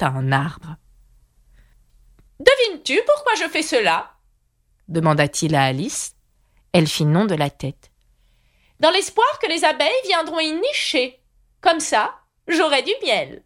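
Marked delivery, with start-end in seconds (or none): 2.4-4.1 put-on high, fussy old knight voice; high knightly voice promising to escort Alice. A narrator's reading. 8.8-13.4 put-on high, fussy old knight voice; high knightly voice promising to escort Alice. A narrator's reading. Knight explains.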